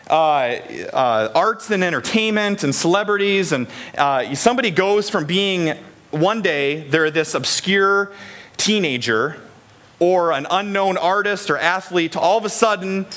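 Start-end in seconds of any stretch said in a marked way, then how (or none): none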